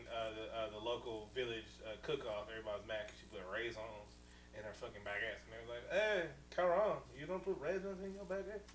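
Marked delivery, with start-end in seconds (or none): 5.9-8.6 mock French accent. Villagers scolding a neighbour over her baguette, mock French accent. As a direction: draw it out in a put-on French accent. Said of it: "in French".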